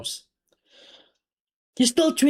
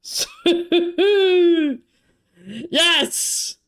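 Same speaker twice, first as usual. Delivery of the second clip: high, ringing shouts of relief and joy; triumphant cheer; 7 semitones higher, slower than usual. Real, not acted. Fan cheering after the goal is confirmed, triumphant shouting. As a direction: roar it as the goal stands.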